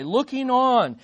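ordinary talk, nothing marked

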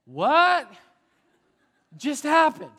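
loud, high, stunned question; bewildered disbelief. Acted. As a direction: blurt it out in baffled disbelief. Acted reaction to abrupt message switch.

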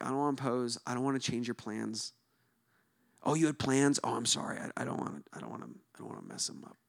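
fading to a breathy whisper; a meek host trailing off into a whisper; timid apologetic. Retold or imitated. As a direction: shrink away, apologizing, until the voice is gone. Timid polite refusal acted out.

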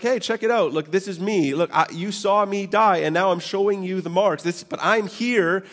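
a lightly voiced cheerful Jesus; casual Jesus. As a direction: voice Jesus like a buddy showing off. Jesus voiced casually showing wounds.

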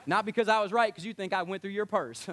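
usual speaking voice

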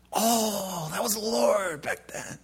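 high, put-on voice of someone catching on late; realizing voice. People looking back realize the Lord was there, high put-on voice. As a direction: play the slow-dawning realization.

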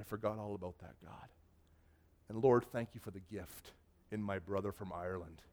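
soft, low prayer of thanks; retold reverent thanks, voice dropped; humble gratitude. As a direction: quietly thank God.

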